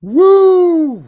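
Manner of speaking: high, loud whoop. Real, not acted. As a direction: shout a big woo. Whoops after laughing.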